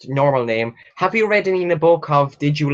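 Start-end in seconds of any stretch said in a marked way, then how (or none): none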